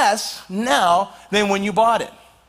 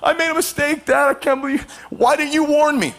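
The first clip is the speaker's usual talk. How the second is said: tearful pleading; 6 semitones higher than usual. Retold or imitated. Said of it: "Like, real tears".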